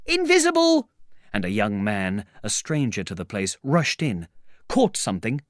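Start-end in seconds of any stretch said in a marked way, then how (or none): 0.1-0.9 high, loud shouts of alarm; excited cries. A narrator's reading. A crowd chasing an unseen man, excited cries. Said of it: "There were excited cries of".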